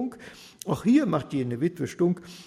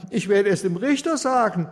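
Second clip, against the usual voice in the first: lament; 5 semitones higher, 7 dB louder than usual. Acted. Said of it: "Ohnmächtig fing sie an zu klagen".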